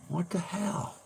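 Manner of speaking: very high, soft puppet squeak; Peanut impression. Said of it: "do Peanut's voice".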